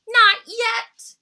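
high, loud protest of a little cub; cried out. Acted. Little bear protests bedtime to Mama, crying out loud. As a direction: cry it out, refusing bedtime. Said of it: "cried Little Brown Bear".

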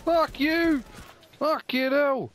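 loud furious high yell; angry shout. Real, not acted. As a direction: scream it out in rage. Raging at enemy in game.

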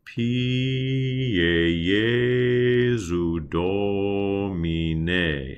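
"Pie Jesu Domine" with every E closed, like French é; slow demo of closed Latin E; closed E vowels. Shown as a demonstration. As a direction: stretch each "e" slow and closed, as in accent aigu. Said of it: "that same vowel is the first syllable of the word Jesu".

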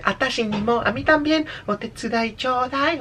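high, girly imitation; imitación femenina. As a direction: mimic a cutesy anime girl. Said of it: "pero ella dice". (voz femenina aguda, imitando)

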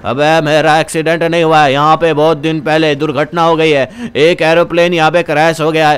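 put-on low old man explaining a tragedy; low-pitched elder character; old villager. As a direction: voice an old local warning of a past crash.

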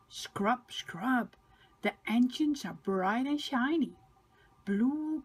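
usual speaking voice

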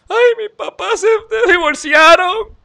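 high, slow mock whine; high mock whimper about parents' divorce; mocking whiner. Mocking someone whining about divorce.

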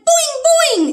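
high loud springy boing; boing noise. Acted. The boy makes his noise, high loud boing. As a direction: bounce the sound up high. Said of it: "He went".

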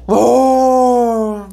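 long loud high cry of joy; excited cry. Real, not acted. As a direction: let out a held cry of joy. Reacting to the final stats. (grito de emoción)

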